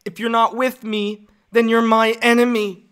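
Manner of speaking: put-on high, drawn-out teenage defiance; Anakin. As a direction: deliver a melodramatic threat, word by word. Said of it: "no, I gotta do Anakin".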